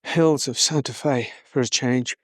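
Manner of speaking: breathless from walking uphill; winded, catching breath between phrases. Real, not acted. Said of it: "Excuse me if I'm a little out of breath".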